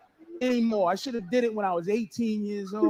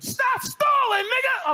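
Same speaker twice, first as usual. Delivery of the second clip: much higher, slow crying shout; higher, louder mock gunman shout; gunman's yell; 13 semitones higher, 3 dB louder, slower than usual. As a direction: scream the threat at him. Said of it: "gunman yelling".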